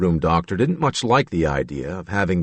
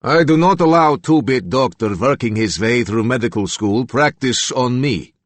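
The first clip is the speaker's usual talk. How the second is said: put-on cold Slavic accent; 4 semitones higher than usual. A narrator's reading. Reagan warns the doctor, cold Slavic accent. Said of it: "that Slavic accent".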